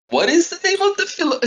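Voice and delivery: high, fast puzzled question; sudden confusion at a strange name; confused. Retold or imitated. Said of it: "I was like".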